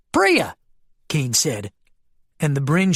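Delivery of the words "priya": much higher, reedy clever voice; high-pitched character voice. Acted. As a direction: voice him high and precise. Keen calling the sheep.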